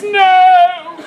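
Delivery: loud, high cry of No; wife's scream. Acted. Wife screams as Caesar chooses power, loud cry. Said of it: "his wife screams".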